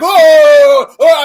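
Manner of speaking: loud realization. Retold or imitated. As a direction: shout the dawning realization. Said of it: "and they're like".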